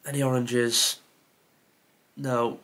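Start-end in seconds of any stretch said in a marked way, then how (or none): none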